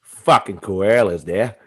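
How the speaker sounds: slow, broad Australian drawl; Australian accent. Shown as a demonstration. Host uses koala to get into accent, broad Australian. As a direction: stretch "koalas" into "ko-ay-las", Australian style. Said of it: "the word that can get me into it".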